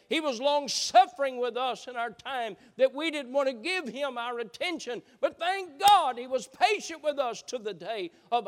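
loud, high fervent preaching; full-force preaching. Acted.

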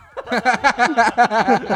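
loud burst of real laughter. Real, not acted. Friends laugh at the grim joke, loud real laugh. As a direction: laugh out hard at the dark joke.

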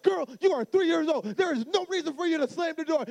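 high, loud acted-out scolding; outraged dad. Dad to three-year-old over slammed door, retold angry scolding. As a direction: tell off a small child in comic outrage.

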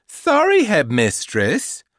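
cheeky high sorry; smug, insincere apology; smirking. Acted. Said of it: "said Mindy with a smirk".

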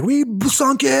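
very high, squealing celebration; mock elated cry. Podcaster voicing the crew's news of the sinking, mock elated.

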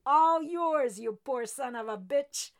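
loud roar across the water. A narrator's reading. Jordan calling to the deckhand from the tug, roaring. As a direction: roar it through cupped hands. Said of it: "cupped his hands over his mouth and roared".

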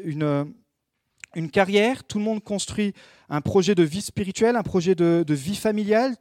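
ordinary delivery

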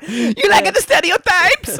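very high, loud, drawn-out mock Italian; cartoon Italian. Comedian getting back at the host, cartoon Italian accent. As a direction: taunt in an exaggerated Italian accent. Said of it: "pigeonholed me into that, into that character".

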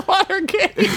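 high-pitched, laughing through the word. Real, not acted. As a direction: giggle it out.

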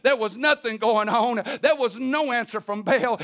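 ordinary delivery

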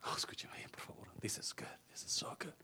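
near-whisper, very quiet; whispered preacher's aside; hushed aside. Real, not acted. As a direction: drop right down to a confiding whisper.

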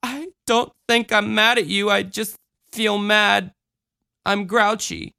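put-on small child holding herself together; child struggling for composure. A narrator's reading. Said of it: "struggled to master her voice".

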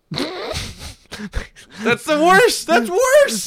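high, drawn-out mock-outraged yell; mock outrage. Real, not acted. Friend reacting to an awful pun, mock outrage. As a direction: yell it in high-pitched disbelief.

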